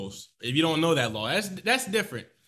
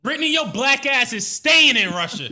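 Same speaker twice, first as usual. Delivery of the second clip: loud, higher laughing jab; laughing outburst; 7 semitones higher, 7 dB louder than usual. Real, not acted. Cohost reacting to the prisoner swap news, loud and laughing. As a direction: blurt it out through laughter.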